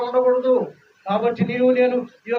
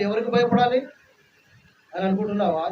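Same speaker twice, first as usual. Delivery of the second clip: normal talking voice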